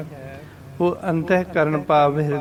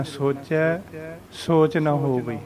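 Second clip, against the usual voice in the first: ਸੋਚਿਆ lifted, then a brief pause; short pause after ਸੋਚਿਆ; slower than usual. Shown as a demonstration. Teacher showing learners the pause, brief stop after ਸੋਚਿਆ. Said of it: "ਥੋੜ੍ਹਾ ਜਿਹਾ ਰੁਕਣਾ".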